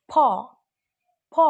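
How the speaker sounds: ফ as a breathy P, between প and ফ; soft aspirated P. Shown as a demonstration. Teacher demonstrating the English P sound to students. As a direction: blend প into ফ. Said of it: "এইভাবে".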